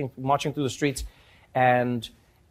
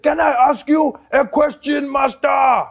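loud, high-pitched old grandfather impression; hammed-up, drawn-out plea to the master; grandfather addressing policeman; 11 semitones higher, 5 dB louder, slower than usual. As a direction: slowly call out in a put-on elder's voice. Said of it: "He said".

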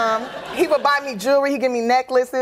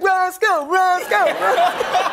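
higher mock chant of a name; calling out; 7 semitones higher, much slower than usual. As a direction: call him across the room. Residents calling out to him.

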